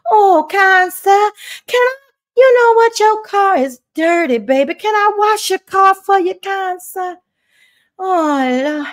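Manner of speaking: put-on eager, fawning Southern accent. Mock fan offering to wash his car, fawning Southern voice. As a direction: beg sweetly like a lovestruck Southern damsel. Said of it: "get out my real Southern voice".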